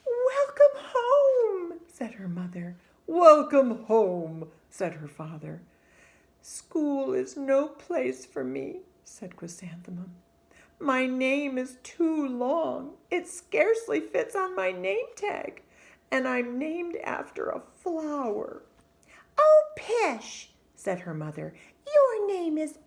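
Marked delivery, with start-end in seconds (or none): 0.1-1.8 much higher, slow, loud crooning mother; sing-song mother. Acted. Mother greets her sad daughter home, crooning sing-song. 3.1-4.5 loud, slow hearty father; booming father. Acted. Father greets his sad daughter, slow and hearty. 6.4-18.6 put-on whiny sad child. Sad girl tells her parents school is no place for her.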